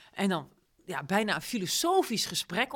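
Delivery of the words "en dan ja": normal talking voice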